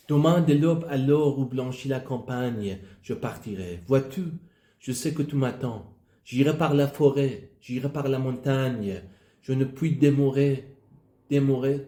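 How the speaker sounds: poem recitation. Acted. Learner reading Victor Hugo's poem aloud, projected recitation. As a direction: recite with firm line openings and falling endings.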